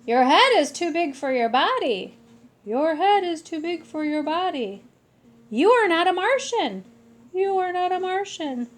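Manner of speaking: playful loud mocking; excited teasing. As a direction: tease him with gusto. Excited teasing repeated lines.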